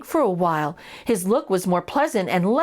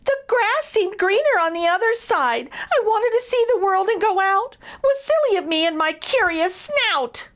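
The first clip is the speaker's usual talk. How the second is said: much higher mouse voice, regretful; high lamenting mouse; 10 semitones higher than usual. A narrator's reading. Elvin telling Braden why he left, high and sorry. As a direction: whimper out the regret.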